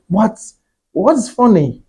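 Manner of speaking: mildly taken aback; puzzled question. Retold or imitated. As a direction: ask it puzzled, pitch jumping up. Retelling asking friends why they laughed.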